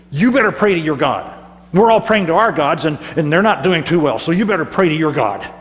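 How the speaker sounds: loud, pressing captain's order; urgent demand. Acted. Captain loudly orders Jonah to pray to his God. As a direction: push him to pray now.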